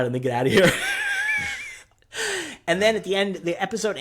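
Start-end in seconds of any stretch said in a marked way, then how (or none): 0.0-0.7 louder, laughing through a quote; laughing quote of Matt. Retold or imitated. 0.8-1.8 high burst of laughter; high laugh at own joke. Real, not acted.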